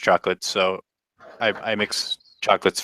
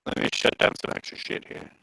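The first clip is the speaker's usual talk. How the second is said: half robot voice; 8 dB quieter than usual. Real, not acted. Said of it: "it's just robot-y".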